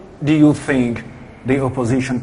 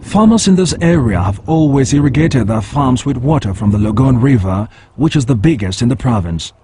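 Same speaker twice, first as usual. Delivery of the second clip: firm, louder field report voice; news field reporter; 6 dB louder than usual. Acted. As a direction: deliver it like a TV correspondent on location. On-location report after farmer interview.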